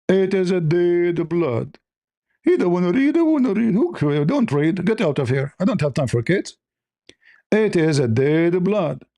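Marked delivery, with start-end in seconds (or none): none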